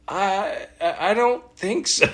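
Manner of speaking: high, hesitant and a bit embarrassed; sheepish admission. Retold or imitated. Rookie answering the director, high sheepish stammer. Admitting he never did ADR.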